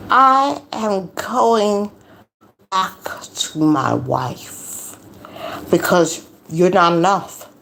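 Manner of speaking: very slow, drawn-out imitation of a breakup speech; exaggerated mock pronouncement. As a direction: deliver his verdict with overdone, spaced-out solemnity. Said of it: "he didn't say it like that".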